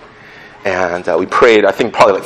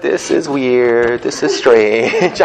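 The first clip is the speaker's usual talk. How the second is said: slow puzzled prayer; much slower than usual. Retold or imitated. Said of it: "And I'm just like".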